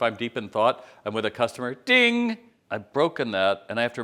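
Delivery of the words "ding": high, louder sung "ding!" like a phone alert; ping it out like a notification chime; mock notification ding.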